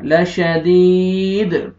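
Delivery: लशदीद slow, द doubled with an echo; qalqala. Shown as a demonstration. Teacher showing the doubled sound. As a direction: bounce the final द of लशदीद. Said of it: "double आवाज आएगा".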